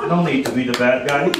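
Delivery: usual speaking voice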